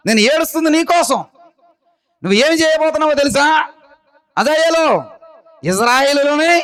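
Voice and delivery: high, ringing preacher's voice; full-force preaching. Acted. Preacher proclaiming Elisha's prophecy to the church, fervent.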